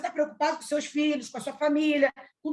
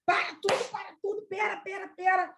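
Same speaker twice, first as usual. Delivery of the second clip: high, hurried, softer stop-everything call; acted-out sudden halt, pitched up; urgent interjection; 6 semitones higher, 3 dB quieter than usual. Telling everyone to stop everything at once, urgent. Abruptly halting everyone mid-story.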